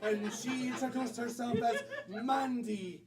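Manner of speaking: storytelling in a put-on Irish lilt; stage Irish accent. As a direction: narrate like an Irish yarn-spinner.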